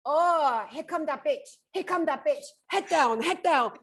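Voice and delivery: high-pitched imitated Vietnamese accent. Imitating nail technicians gossiping about a customer, mock Vietnamese accent. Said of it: "I love her accent".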